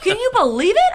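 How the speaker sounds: squealed high and loud; over-the-top amazement. Retold or imitated. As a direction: shriek with disbelieving joy. Retelling being impressed.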